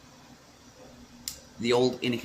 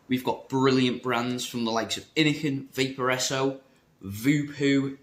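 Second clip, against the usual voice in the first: ordinary delivery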